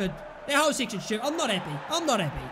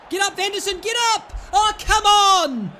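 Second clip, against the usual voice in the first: higher and louder, yelling at the screen; frustrated shouting; 8 semitones higher, 8 dB louder than usual. Real, not acted. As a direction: yell at your player to get up. Frustrated player yelling at game.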